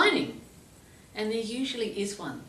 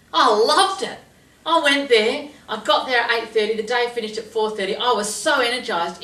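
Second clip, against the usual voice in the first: loud, higher gushing delight; thrilled; 5 semitones higher, 10 dB louder than usual. Retold or imitated. Student telling therapist about trial, thrilled. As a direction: gush with excitement.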